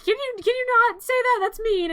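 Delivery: high, fast hurt protest; high rushed offended mom; hurt mother. Retold or imitated. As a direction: squeak it out, offended and quick. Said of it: "the lady's like".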